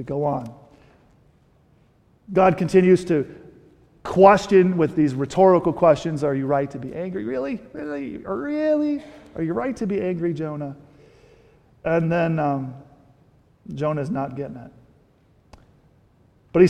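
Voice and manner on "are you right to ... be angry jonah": gentle questioning. Retold or imitated. God's rhetorical questions, hushed.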